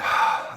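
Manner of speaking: loud full sigh out; audible exhale. Shown as a demonstration. Meditation host showing group the out-breath, big audible sigh. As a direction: let the breath out in one big release.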